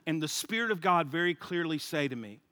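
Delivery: ordinary talk, nothing marked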